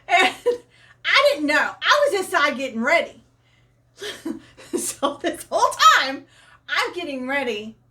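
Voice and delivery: high, loud, breaking into laughter; tickled, voice riding up with giggles; laughing while talking. Real, not acted. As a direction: protest through real laughter. Cracks up telling dog chase.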